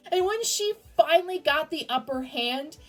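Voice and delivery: ordinary talk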